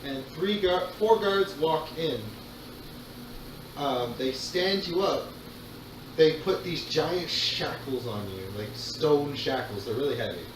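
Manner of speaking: ordinary talk, nothing marked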